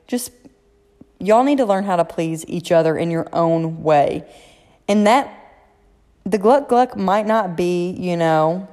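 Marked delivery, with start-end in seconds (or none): none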